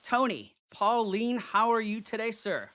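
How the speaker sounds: usual speaking voice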